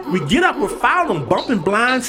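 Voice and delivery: usual speaking voice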